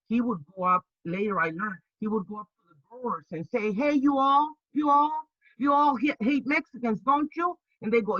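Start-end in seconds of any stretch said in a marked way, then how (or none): 3.7-7.6 put-on Southern accent. Investigator baiting growers in a Southern accent. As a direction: play the sly Southern investigator, pitched up. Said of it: "Southern accent".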